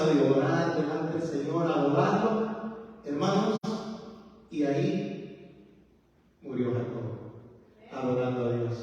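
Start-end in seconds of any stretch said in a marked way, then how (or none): 4.5-8.8 much lower and softer, slow; hushed. Real, not acted. Preacher telling how Jacob died, hushed and slow. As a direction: let the voice sink to a hush.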